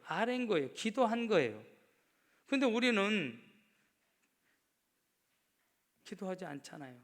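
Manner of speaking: loud, rising sermon cry; full-force sermon; fiery preaching. Acted. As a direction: preach it hard to the congregation.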